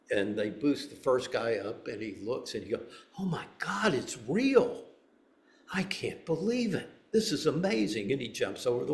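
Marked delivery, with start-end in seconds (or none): 3.2-8.1 higher, awestruck cries of discovery; ecstatic wonder. Acted. Seeker peering over the wall at Eden, awestruck and high. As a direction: gasp it out like you've found paradise.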